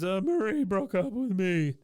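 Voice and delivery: put-on high, loud whiny boy wail; mock sobbing. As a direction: blubber it like a dumped kid. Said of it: "sobbing Bobby come in".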